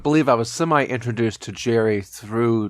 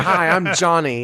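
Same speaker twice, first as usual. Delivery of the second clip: slow, higher camp greeting; flamboyant character; 5 semitones higher, slower than usual. Retold or imitated. Jim Carrey's camp sketch character greeting the party.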